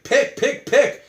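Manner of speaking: high-pitched, insistent prodding; mock urgent, repeated high-pitched command; urgent demand. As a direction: imitate someone pressing for a choice. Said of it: "the guy's like".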